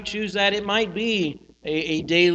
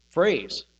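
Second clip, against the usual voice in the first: normal talking voice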